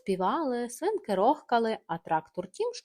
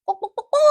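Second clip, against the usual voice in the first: panicked hen cackle; 12 semitones higher, 6 dB louder, faster than usual. Acted. Hen jumps down.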